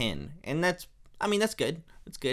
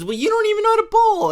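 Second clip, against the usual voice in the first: much higher, louder and fast; mocking Marge; 9 dB louder, faster than usual. Marge snapping at Homer about bowling, high, loud and fast. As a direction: fire it back in a high shrill jab. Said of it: "And then she goes".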